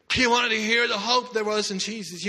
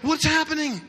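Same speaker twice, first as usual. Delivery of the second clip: mild mock scream; 6 semitones higher than usual. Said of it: "He screams out".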